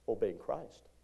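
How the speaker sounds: normal talking voice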